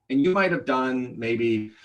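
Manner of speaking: usual speaking voice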